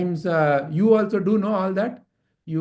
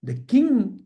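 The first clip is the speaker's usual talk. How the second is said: ordinary delivery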